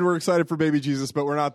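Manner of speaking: ordinary talk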